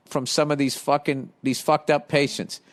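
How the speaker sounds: ordinary talk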